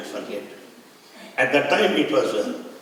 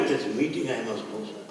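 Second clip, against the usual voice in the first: softer, lower, humble pleading; meek soft plea; 5 semitones lower, 8 dB quieter than usual. Retold or imitated. Speaker pleading with the leader at the roadblock, meek and soft. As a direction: quietly beg to be let through.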